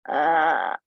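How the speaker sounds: low breathy ahh with tongue out; lion breath ahh. Shown as a demonstration. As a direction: exhale a long lion's ahh. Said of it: "et là, on tire la langue à la fin".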